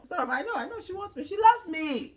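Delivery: high, squeaky mock man. Mocking him for a friend, high and whiny.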